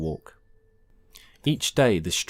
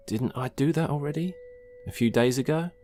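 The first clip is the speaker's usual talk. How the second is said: higher, rising questioning tone; puzzled self-questioning; 8 semitones higher than usual. Acted. Sen doubting his memory over his mother's reminder, puzzled. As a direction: ask yourself, unsure, lifting at the end.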